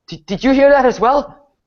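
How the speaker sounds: a scared officer asking for reassurance; frightened lieutenant. Acted. Lieutenant asking the party, high and alarmed. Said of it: "looks towards you and says".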